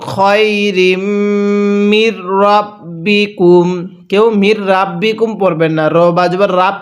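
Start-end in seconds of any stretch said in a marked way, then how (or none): 0.1-3.7 Quran recitation. Shown as a demonstration. Teacher reciting for students to repeat. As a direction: recite each syllable with care. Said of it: "কিভাবে পড়ব?".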